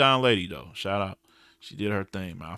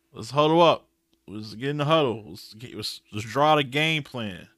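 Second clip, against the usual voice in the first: usual speaking voice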